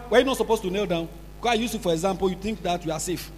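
normal talking voice

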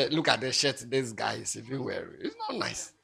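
hushed, catty gossip; mock gossip aside. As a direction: mimic a snide whisperer. Acting a gossip's sneer.

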